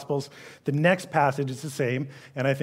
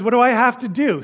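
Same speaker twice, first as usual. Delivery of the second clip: mock rich man, higher and louder; eager rich man; 7 semitones higher, 6 dB louder than usual. Preacher voices the rich man asking Jesus, eager. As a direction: play the keen young man asking.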